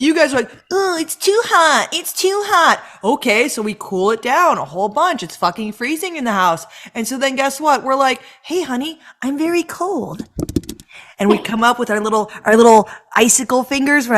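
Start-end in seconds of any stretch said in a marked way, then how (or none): 0.7-2.8 high slow whiny mimic; lightly whiny husband complaint; mock whining complaint. 8.4-10.3 softer, slow cutesy plea; soft mock plea to the husband; soft sweet plea. Wife sweetly asking.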